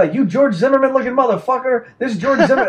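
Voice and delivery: high-pitched mock accusing yell; retold yelling. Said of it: "yelling at me".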